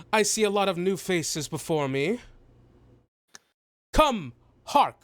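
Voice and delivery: high, declaiming pompous voice; lofty, theatrical self-importance; grandiose halfling dignitary. Acted. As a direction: proclaim it to an awed crowd. Game master plays grandiose halfling.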